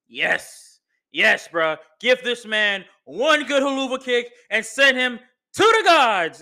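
rising to a loud hype yell; excited cheering. Retold or imitated. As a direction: hype him up like a fan in the stands. Cheering on Sami in quote.

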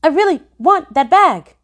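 staccato. A narrator's reading. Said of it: "voice staccato".

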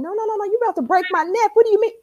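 high, indignant disbelief, half laughing; high mock-indignant no no no; incredulous retort. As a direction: shoot back in mock outrage that he only worries about the phone. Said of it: "I was like".

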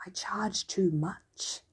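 slow, soft whisper; whispered slowly to a bear at the door. Acted. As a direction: breathe it out slowly and quietly. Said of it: "still in a whisper".

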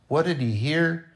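ordinary delivery

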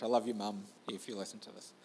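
whispered aside. Real, not acted. Aside to his mum.

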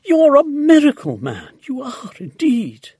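high, loud, gushing admiration; delighted cry. A narrator's reading. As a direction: heap praise on a hero. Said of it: "Missus Silver cried".

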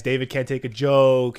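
ordinary delivery

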